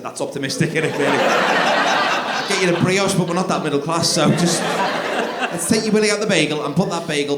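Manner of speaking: put-on gently steer a kid away; coaxing parent. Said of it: "He said".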